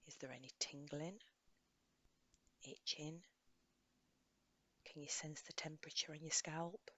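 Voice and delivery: soft, unhurried guidance with long pauses; guided meditation. Acted. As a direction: gently lead a listener through a body scan. Leads a body scan meditation.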